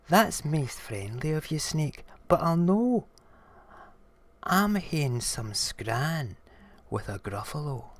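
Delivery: high start, easing down; politely declining mouse. Acted. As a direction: turn down the invitation in a little mouse voice.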